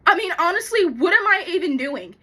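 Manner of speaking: loud, high-pitched outburst; exasperated. Acted. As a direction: exclaim in tearful frustration at yourself. Exasperated at talking to plant.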